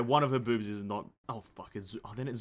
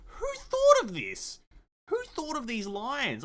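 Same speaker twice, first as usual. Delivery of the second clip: amazed; 12 semitones higher, 4 dB louder, slower than usual. Real, not acted. Amazed at the poem.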